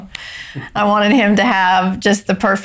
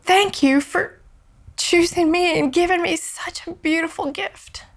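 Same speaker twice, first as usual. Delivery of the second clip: higher, choked voice near tears; tearful gratitude; 9 semitones higher, 3 dB quieter than usual. Real, not acted. Thanking God for the gift, choked up with tears. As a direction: give thanks while crying. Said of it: "and I'm just sobbing".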